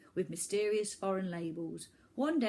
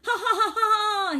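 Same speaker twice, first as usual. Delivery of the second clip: loud, high villainous cackle; 14 semitones higher, 12 dB louder than usual. Acted. Boy villain cackles at the children, loud and high. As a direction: cackle with glee. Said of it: "he cackled".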